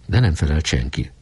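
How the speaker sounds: usual speaking voice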